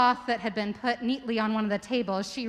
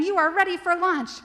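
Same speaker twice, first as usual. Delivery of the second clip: mission control call; 8 semitones higher, 5 dB louder than usual. Acted. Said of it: "their sounds saying".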